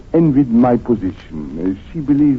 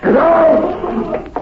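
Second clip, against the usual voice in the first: shouted order; 3 dB louder, much slower than usual. Acted. Consul ordering the furious wife out, shouting. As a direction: get out, rising higher each time.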